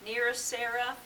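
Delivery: low, soft, measured verse recital; hushed, stately poem voice; poetry reading. Acted. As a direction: read it gently, pausing at line breaks. Reads Poe poem aloud.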